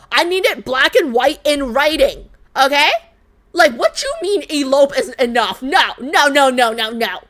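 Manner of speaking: loud, high indignant rant; getting mad. Real, not acted. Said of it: "I'm getting madder the more I speak".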